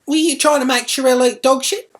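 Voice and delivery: high, loud accusing yell; furious mum confronting her daughter, loud and high; angry demand. Retold or imitated. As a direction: yell it at the guilty child.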